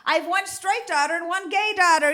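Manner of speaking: ordinary talk, nothing marked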